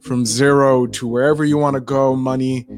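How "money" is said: ordinary talk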